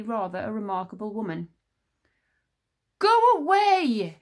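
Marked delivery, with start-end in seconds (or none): 3.0-4.2 put-on high, loud boyish shout; slow, loud, high cry of refusal. A narrator's reading. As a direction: yell at him to leave. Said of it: "shouted Tsar".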